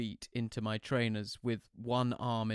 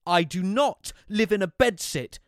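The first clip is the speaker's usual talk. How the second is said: louder, higher, clipped retort; snapped; 8 semitones higher, 11 dB louder than usual. A narrator's reading. Son snapping at Mum, louder and higher. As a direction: snap back in irritation. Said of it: "I snapped".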